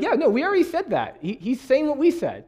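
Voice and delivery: high, put-on voice of annoyed rabbis; mock exasperated rabbis. Rabbis scoffing at the copied teaching, mock exasperated. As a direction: scoff like rabbis whose words were copied. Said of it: "'Cause they're like".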